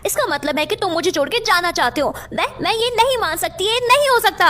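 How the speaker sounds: distraught disbelief. Acted. Earth fears moon leaving her.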